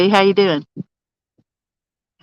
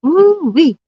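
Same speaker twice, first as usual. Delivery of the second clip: excited whoop; 8 semitones higher than usual. Real, not acted. Reacting to the big fish.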